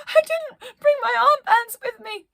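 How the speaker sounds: high, loud panicky wail; a scared crew member crying out while falling; panicked cry. Acted. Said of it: "Yoga Breaths cried".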